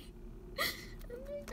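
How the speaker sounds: a wet, high little laugh breaking into a sob; tearful laugh-sob. Real, not acted. Recalling the lost pregnancy, a tearful laugh. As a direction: laugh through real tears.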